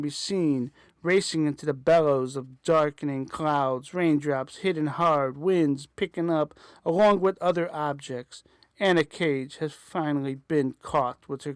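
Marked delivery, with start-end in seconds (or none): none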